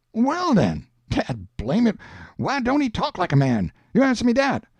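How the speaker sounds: high-pitched, exasperated challenge in dialect; Jim, triumphant exasperation. Acted. Jim clinching his argument with Huck, high and exasperated.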